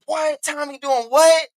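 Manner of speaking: high-pitched mock shock; shocked disbelief. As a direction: sound stunned, voice jumping up. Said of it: "to get to the phone like".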